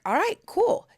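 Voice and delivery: high laid-back mock dude; breezy imitation of the ex; imitating ex. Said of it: "And he's like".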